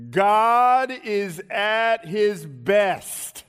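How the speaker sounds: very slow, loud proclamation that falls away; booming opening, measured descending close; emphatic preaching. Real, not acted. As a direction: stretch every word so it sinks in. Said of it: "I'll say it again".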